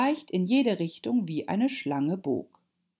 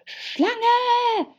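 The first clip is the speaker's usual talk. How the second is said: high, loud bird-like shriek; screech; 7 dB louder than usual. A narrator's reading. Pigeon spotting Alice among the treetops, screeching. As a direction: screech it out at the top. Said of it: "kreischte die Taube".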